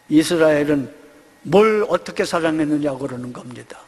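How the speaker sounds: normal talking voice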